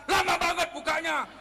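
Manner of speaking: high-pitched irritated complaint; mock angry husband. Late husband to wife at the door, acted anger. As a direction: grumble loudly like a husband kept waiting. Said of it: "dia marah duluan".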